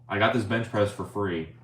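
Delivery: normal talking voice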